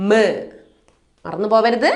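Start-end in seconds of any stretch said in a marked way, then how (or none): 0.1-0.1 "മ" as a short, isolated M sound; letter sound of M. Shown as a demonstration. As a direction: say "മ" as the bare phonics sound, not the letter name. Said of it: "What is the sound of M?".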